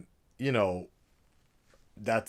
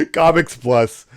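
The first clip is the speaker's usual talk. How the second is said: loud intro; 4 semitones higher, 16 dB louder than usual. Pretend. Mock announcer channel intro.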